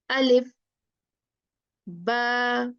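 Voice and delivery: each letter name drawn out, slowly; slow alphabet recitation. Shown as a demonstration. Teacher slowly reciting the alphabet to students.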